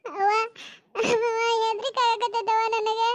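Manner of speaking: frightened, crying to mother; tearful fear. Acted. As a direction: whimper for your mother under threat. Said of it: "ಅಳ್ಬೇಡ ಮಗಳ, ಅಳ್ಬೇಡ". (ಹೆದರಿ ಅಳುವ ದನಿ)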